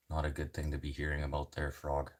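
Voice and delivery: usual speaking voice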